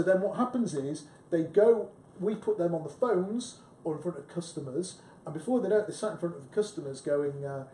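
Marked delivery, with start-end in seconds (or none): none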